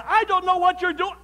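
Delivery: high, loud acted outburst; fed-up husband losing patience; exasperated scolding. Acted quarrel with wife.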